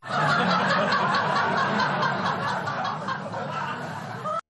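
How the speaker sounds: long high cackle at his own punchline; laughing at own joke. Real, not acted. Host cracking up at his own duck joke, real laughter.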